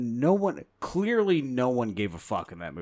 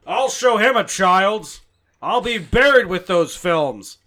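slow, much louder, higher defiant Ford; loud Harrison Ford; 8 semitones higher, 14 dB louder, slower than usual. Pretend. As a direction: growl a threat, drawn out. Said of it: "you have to do Harrison Ford loud".

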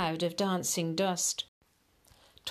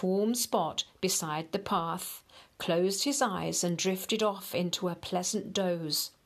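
usual speaking voice

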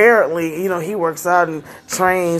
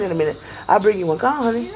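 ordinary delivery

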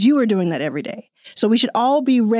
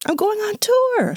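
much higher, slow, sing-song going on tour; excited announcement; 13 semitones higher, slower than usual. Real, not acted. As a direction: stretch out I'm going on tour with glee. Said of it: "Hey, so I'm going on tour".

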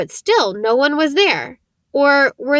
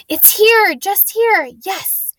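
much higher, bursting with relief at finding it; excited discovery; 9 semitones higher, 4 dB louder, slower than usual. A narrator's reading. Harry to Ron in the dark corridor, thrilled to find the room. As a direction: cry out that it's found at last.

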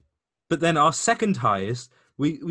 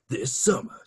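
horror trailer announcer. Shown as a demonstration. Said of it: "movie announcer of, like, a really bad horror".

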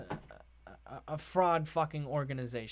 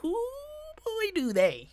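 very high, hooting exclamation; comic falsetto repeat; much slower than usual. Acted. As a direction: squeal it in exaggerated agreement. Comic repeat after a giggle.

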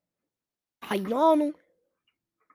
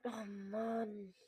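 quiet defeated sigh; 14 dB quieter than usual. Real, not acted. Frustrated after losing.

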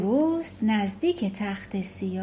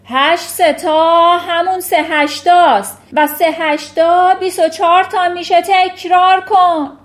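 much higher and louder barked order; furious headmistress shout; 8 semitones higher, 13 dB louder than usual. Acted. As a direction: yell it while swinging the boy. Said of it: "فریاد کشید".

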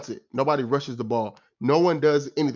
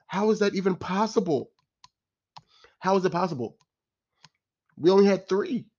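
exasperated disbelief; 6 semitones higher than usual. Real, not acted.

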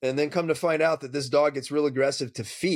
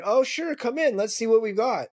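high, breezy welcoming voice; lightly voiced cheerful homeowner; cheery client; 6 semitones higher than usual. Client welcoming the trainer in, high breezy voice.

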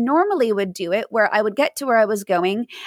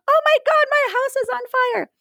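much higher, shrill panic into the phone; panicked scream. Retold or imitated. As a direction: scream it like the house is burning. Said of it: "you're screaming into the phone".

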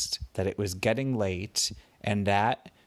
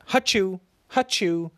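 sneezing; 8 semitones higher, 3 dB louder than usual. Acted. Storyteller acts out a sneeze.

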